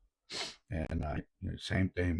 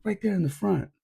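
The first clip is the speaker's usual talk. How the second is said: put-on high, louder child voice; kid pointing out; 13 semitones higher, 5 dB louder than usual. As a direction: point him out like a little son. Son points out the coach.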